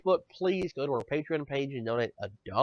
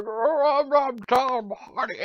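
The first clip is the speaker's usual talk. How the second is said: mock Tom Hardy; 10 semitones higher, slower than usual. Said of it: "It's like".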